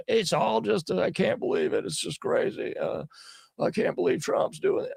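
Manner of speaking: high, whiny, half-whispered mock lament. Host mocking AJ breaking down on air, whiny high lament. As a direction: play a blubbering man in mock disbelief. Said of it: "So he was finally like".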